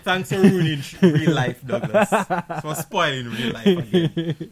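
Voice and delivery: loud, sarcastic thanks with a laugh in it; exasperated scolding. Real, not acted. Host scolding Douglas for spoiling real life, exasperated. Mock quarrel over a spoiler.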